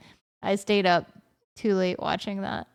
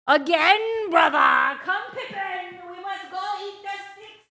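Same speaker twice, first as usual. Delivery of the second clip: theatrical brother character; 11 semitones higher than usual. Pretend. Owner leading Pippi out to the sticks, mock-heroic. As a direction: call it out grandly while walking away. Takes dog out in play voice.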